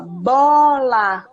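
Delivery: "bola" drawn out slowly, syllable by syllable; teacher sounding out "bola" for a child; sounding out the word. Shown as a demonstration. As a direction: stretch out "bola" so each sound is heard. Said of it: "o som da bola".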